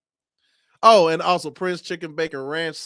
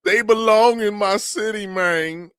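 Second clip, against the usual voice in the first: higher, louder, mock desperate begging; hammed-up pleading plea; pleading mayor; 5 semitones higher, 4 dB louder, much slower than usual. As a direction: imitate the mayor begging to keep the team. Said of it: "He's, like, trying his hardest".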